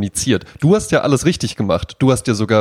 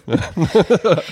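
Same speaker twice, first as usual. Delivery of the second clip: bright burst of laughter; high, open laugh; real laugh; 7 semitones higher than usual. Real, not acted. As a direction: laugh out at the silly picture. Laughing at own absurd scenario.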